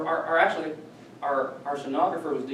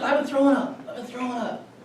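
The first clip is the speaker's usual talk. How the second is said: mock moaning patient voice, pitched higher; imitating sick patient; 9 semitones higher than usual. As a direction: play the nauseated patient complaining. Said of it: "she's throwing up".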